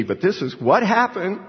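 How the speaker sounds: high-pitched, emphatic retelling; imitated insistence. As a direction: act out someone standing by an unlikely story. Romanian insisting he is believed.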